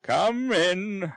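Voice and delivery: put-on a kindly old lady calling out. Old lady calls to the visitor, lightly voiced.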